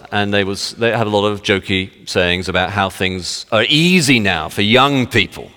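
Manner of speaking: ordinary talk, nothing marked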